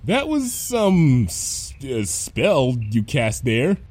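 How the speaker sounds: very slow, halting compliment; drawn-out, guarded words; strained politeness. Acted. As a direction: catch yourself mid-word, then choose carefully. Teacher stops short of swearing.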